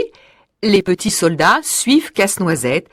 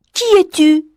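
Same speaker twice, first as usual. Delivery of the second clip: curious Marie; 8 semitones higher than usual. A narrator's reading. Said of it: "demande Marie".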